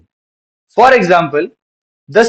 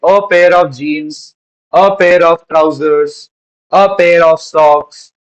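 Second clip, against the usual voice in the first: each phrase drawn out slowly and clearly; slow model phrases; much slower than usual. Shown as a demonstration. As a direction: dictate the examples slowly. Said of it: "बिल्कुल इसी तरीके से". (धीमे, साफ़ बोलकर लिखवाना)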